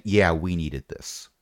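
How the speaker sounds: a hint of a dad, low and slow; soft, unhurried dad remark, lightly put on; lightly voiced dad. Dad looking round at the rain, lightly voiced dad. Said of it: "giving off dad vibes".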